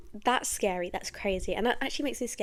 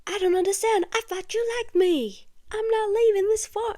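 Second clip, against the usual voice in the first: put-on high, raised southern-accented wail; Mia Goth; 10 semitones higher, 5 dB louder than usual. Acting out a film scene for listeners, high screamy southern accent. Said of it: "she's got a southern accent, and she screams".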